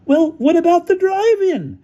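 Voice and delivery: high, loud, drawn-out challenge; wailing, high-pitched comeback; indignant howl. Acted. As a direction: howl the question in outrage. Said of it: "howled Featherstone".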